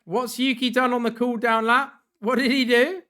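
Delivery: loud, high, excited calling; excited shouting. Real, not acted. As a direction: hype it up to chat.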